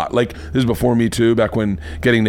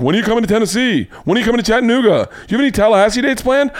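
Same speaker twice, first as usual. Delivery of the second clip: high, fast eager fan voice; 9 semitones higher, faster than usual. Retold or imitated. Host mimicking fans asking for tour dates, high and fast. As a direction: rattle it off like an overexcited fan.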